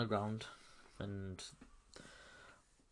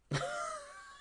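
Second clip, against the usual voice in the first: high squeal laugh; 4 dB quieter than usual. Real, not acted. Laughing at absurd stunt.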